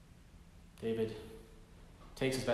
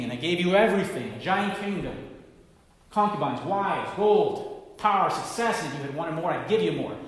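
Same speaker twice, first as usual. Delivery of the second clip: a prophet confronting King David in God's name, loud and stern; dramatic accusation; 2 semitones higher, 8 dB louder than usual. Acted. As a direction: point at the king and thunder it.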